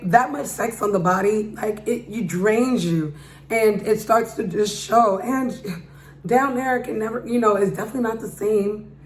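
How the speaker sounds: ordinary delivery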